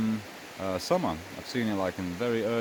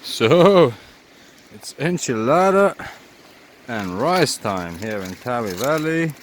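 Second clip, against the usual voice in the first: excited announcement; 2 semitones higher, 10 dB louder than usual. Real, not acted.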